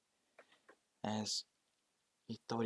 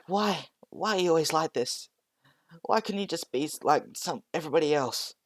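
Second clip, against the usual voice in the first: high, loud, pleading cry; desperate plea; 7 semitones higher, 4 dB louder than usual. Acted. Deku crying out at his bully, high and pleading. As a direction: plead with him, voice pitched up in distress.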